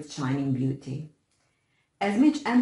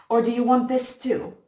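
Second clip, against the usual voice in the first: brutal taunt; 7 semitones higher, 7 dB louder than usual. A narrator's reading. Bully over fallen brother.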